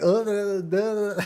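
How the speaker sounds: loud, high wobbling "uh-uh-uh"; shaken grunts. Retold or imitated. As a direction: imitate being shaken by the shoulders. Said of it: "like, shaking me like this".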